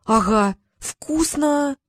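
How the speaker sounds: put-on high, drawn-out boyish relish; contented boy. A narrator's reading. Boy biting into his halva, contented.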